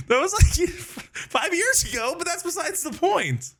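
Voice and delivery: high giggly words through laughter; laughing. Real, not acted. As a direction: laugh while you say it. Laughing over old memory.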